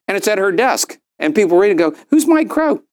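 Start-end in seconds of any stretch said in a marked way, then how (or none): 2.1-2.8 mock-curious, much higher on Mike; a high, nosy who's-that; curious question. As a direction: ask it like you're intrigued. Curious readers quoted.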